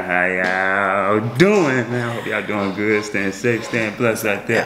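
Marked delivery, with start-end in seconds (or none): 0.0-1.9 very slow, stretched greeting with a falling end; drawn-out greeting. Acted. Host greeting viewers again in a slow, stretched-out drawl. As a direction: stretch it out like a lazy radio host.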